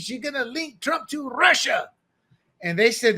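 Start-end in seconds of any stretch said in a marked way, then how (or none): 0.0-1.9 high, hammed-up fake Dutch accent; mock Dutch accent. Dutch intelligence tipping off the FBI, in a mock Dutch accent. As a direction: warn them in a bad, Spanish-sounding Dutch accent. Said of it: "That's my Dutch accent".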